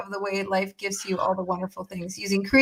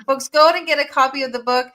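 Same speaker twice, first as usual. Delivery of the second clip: normal talking voice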